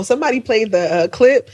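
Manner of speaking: ordinary talk, nothing marked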